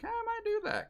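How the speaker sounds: imitating own excited reaction to the tour news; mock eager self. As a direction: toss it off like a sudden happy plan. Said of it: "I'm like".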